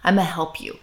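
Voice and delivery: soft, tender limit-setting; loving, firm reassurance. Acted. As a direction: hold the boundary lovingly, voice hushed. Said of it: "And really lovingly say".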